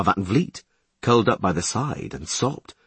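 ordinary delivery, nothing marked

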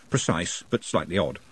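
ordinary delivery

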